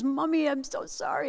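put-on high, soft child voice; small, quiet mock-child plea; imitated little boy. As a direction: apologize like a small, sorry kid. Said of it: "Lukey comes over".

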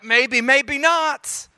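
slow, high-pitched noncommittal drawl; doubtful hedging. Acted. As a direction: draw it out, unconvinced. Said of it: "Or somebody else to be like".

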